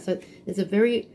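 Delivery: usual speaking voice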